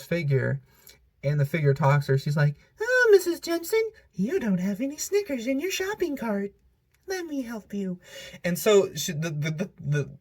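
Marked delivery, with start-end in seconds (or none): 2.8-8.0 high put-on voice of a fake lady, fading to soft. YouTuber imitates the ad's fake lady talking to a shopper, high mock voice. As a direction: squeak it out like a creepy masked figure. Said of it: "the voice is so funny".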